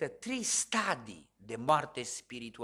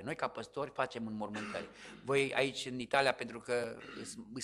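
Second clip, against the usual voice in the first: normal talking voice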